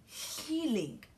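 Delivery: high, drawn-out "Healing"; quoted angel voice. Retold or imitated. Preacher quoting the angel, lifted high. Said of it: "she said".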